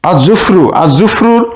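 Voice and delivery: "আর জুফরু" with no L, doubled consonant; silent lam. Shown as a demonstration. Teacher repeating for students, lam silent in "আর জুফরু". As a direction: run the article into the Z sound of "আর জুফরু". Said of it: "লাম উচ্চারণ হচ্ছে না".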